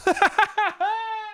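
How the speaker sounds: a sudden high nervous burst of laughter. Real, not acted. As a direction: laugh at your own panic, high and sharp. Nervous thought of date showing up.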